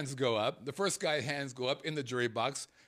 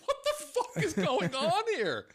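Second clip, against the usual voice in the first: can't believe it, laughing as you say it; laughing disbelief; 4 dB louder than usual. Retold or imitated. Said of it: "so I started to laugh".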